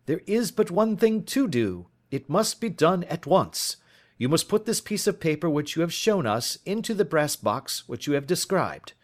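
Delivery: high, insistent detective voice; urgent detective. A narrator's reading. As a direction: press the client hard, pitch well up. Voices Holmes giving urgent orders.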